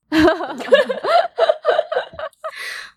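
long high laugh; real teary laughter. Real, not acted. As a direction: laugh off the tears. Laughing after a teary story.

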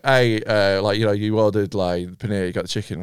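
hint of the caller, toned down, no accent; second guy on the phone. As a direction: drop low and hushed as the second takeaway guy. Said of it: "Do his voice".

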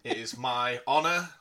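grand showman announcement; ringmaster. Acted. Grand introduction of the performance.

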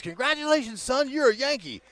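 high, bright mom's congratulations; excited announcement. Retold or imitated. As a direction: announce the big news proudly. Mom announces he's drafted.

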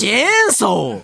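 チェーンソー slow and exclaimed, stress on チェ and ソ; chainsaw on request. Shown as a demonstration. Co-host asks for it, American chainsaw accent called out slowly. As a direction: belt out チェーンソー slowly, hitting チェ and ソ. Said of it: "チェーンソーって発音してくれる".

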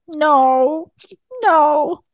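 mock whimper of a crying kid; imitated crying. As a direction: mimic an upset child refusing. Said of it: "so she starts crying".

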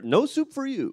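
Soup Nazi voice. Pretend. Quoting the Seinfeld soup line.

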